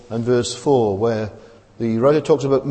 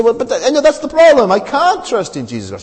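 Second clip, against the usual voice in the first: exasperated doubter; 9 semitones higher, 6 dB louder than usual. Retold or imitated. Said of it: "And you say".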